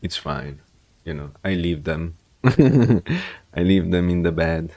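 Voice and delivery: ordinary delivery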